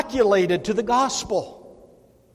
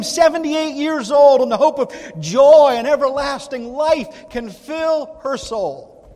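louder, swelling with joy; rising to full voice; 3 semitones higher, 7 dB louder than usual. Real, not acted.

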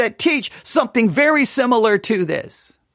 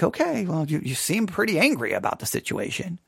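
dry mock calm; 4 semitones lower, 8 dB quieter than usual. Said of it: "and I'm like".

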